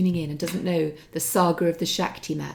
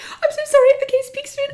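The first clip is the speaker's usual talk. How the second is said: much higher, louder flustered rush; flustered apology; 6 dB louder than usual. Retold or imitated. Caught out on loudspeaker, flustered high apology. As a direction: babble an embarrassed high apology. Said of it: "And I went".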